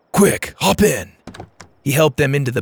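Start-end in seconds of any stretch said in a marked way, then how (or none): 0.1-1.1 high, emphatic urging; urgent command. A narrator's reading. Driver hurries the kids into truck, urgent. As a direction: urge them aboard.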